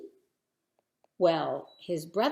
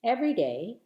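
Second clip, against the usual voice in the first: ordinary delivery, nothing marked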